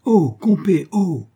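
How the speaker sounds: loud, high call across the water; hailing call. Acted. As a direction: call out to the boat. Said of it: "Robin le héla".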